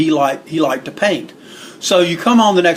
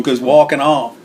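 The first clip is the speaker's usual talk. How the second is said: normal talking voice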